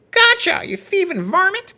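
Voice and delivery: high, loud furious yell; angry shout. A narrator's reading. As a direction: catch the thief in the act. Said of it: "shouted a voice".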